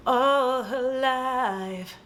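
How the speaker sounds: mock-epic, each word held long; drawn-out melodrama. Storyteller hamming up the long-awaited moment, drawn-out melodrama. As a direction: stretch it out very slowly. Dramatic build-up in retold plot.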